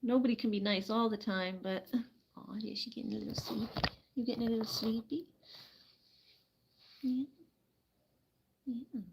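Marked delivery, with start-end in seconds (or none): 2.4-7.2 whispered, fast sleepy cooing; much softer whisper to a dozing hen. Real, not acted. 8.7-9.0 soft teasing. Real, not acted. Teasing sleepy hen softly.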